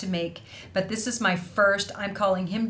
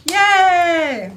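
cheer; 10 semitones higher, 11 dB louder than usual. Real, not acted. Celebrating prize winner Lori.

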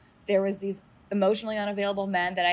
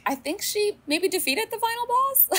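much higher, playful triumph; gleeful; 13 semitones higher than usual. Real, not acted. Host declaring Jen beat the boss, high and gleeful. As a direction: declare victory with a grin.

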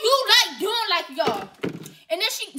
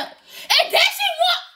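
shocked yell; 10 semitones higher, much slower than usual. Real, not acted. Mock outrage at daughter.

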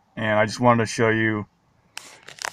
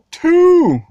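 high, loud squealed two; excited reveal; 6 dB louder than usual. Acted. Collector revealing his two exclusives, loud high squeal. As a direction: shout the punchline with glee.

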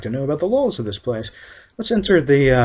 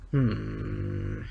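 ordinary talk

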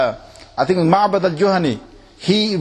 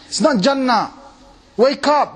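imagined wake-up call; 8 semitones higher, slower than usual. Retold or imitated. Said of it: "it's almost like you think".

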